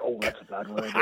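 a hint of his English missus correcting him; wife's voice. Telling her husband the word is rude, lightly voiced wife. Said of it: "and she's like".

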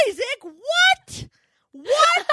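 high, drawn-out incredulous question; stunned disbelief. Retold or imitated. Mother to toddler on spotting the lost pacifier, incredulous. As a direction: pitch way up, dumbfounded. Retold shock at finding pacifier.

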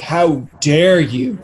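drawn-out mock indignation; hammed-up offended how dare you; mock outrage. Touchy critics answering any negative remark, mock outrage.

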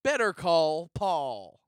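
slow, weighty title drop; dramatic title reveal. Acted. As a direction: announce the title like a trailer. Announcing the show title.

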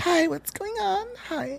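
put-on very high, slow falsetto girl voice. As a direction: stretch it out in a high girly voice. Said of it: "Yeah, it's hard".

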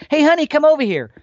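high, loud call across the room. Retold or imitated. Man calling his honey over, loud friendly call. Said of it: "just like if you said".

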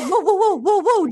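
high, rapid string of whoas; mock alarmed intervention. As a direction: imitate bystanders breaking up a fight. Acting out bystanders calming a fight.

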